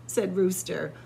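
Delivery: usual speaking voice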